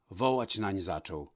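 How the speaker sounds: usual speaking voice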